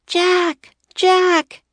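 put-on high, loud girl's shout; Annie shouting. A narrator's reading. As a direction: call out hard for a lost brother. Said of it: "he heard Annie shouting".

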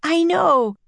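high-pitched desperate reply. A narrator's reading. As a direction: answer high, at a loss. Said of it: "He was desperate".

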